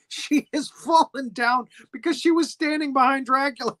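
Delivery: ordinary delivery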